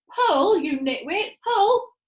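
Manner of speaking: loud, high heave-ho shout; straining shout. A narrator's reading. Monkey father driving the pullers, loud shout. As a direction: yell them into pulling.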